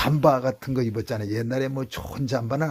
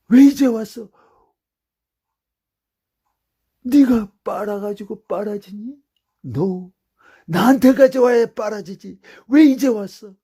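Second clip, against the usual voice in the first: loud, high loving call; God as a parent; 9 semitones higher, 10 dB louder than usual. Acted. God speaking like a parent to the returning sinner, loud and high. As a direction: call him home with loud love.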